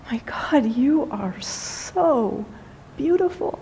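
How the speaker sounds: half-whispered, drawn-out wonder; hushed awe. Retold or imitated. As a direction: let it out slowly, softly amazed. Man marvels at the cat.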